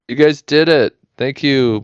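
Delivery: normal talking voice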